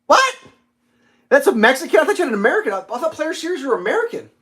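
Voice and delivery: wait, that's not what I thought; surprised disbelief. Real, not acted. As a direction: pitch jumps up in disbelief. Surprised at listener's guitar.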